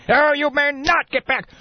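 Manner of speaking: slow, high caricature of a scolding boss; Ebenezer Scrooge. Acted. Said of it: "Mister Scrooge".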